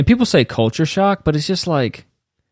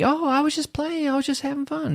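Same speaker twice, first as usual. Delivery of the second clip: high, soft, innocent put-on voice; mock innocent excuse; mocking the hugger; 7 dB quieter than usual. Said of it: "he didn't think".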